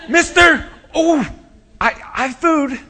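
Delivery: put-on very high, loud child's voice; eager little boy. Preacher plays a boy offering his lunch, eager high-pitched shout. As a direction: pipe up excitedly like a small boy.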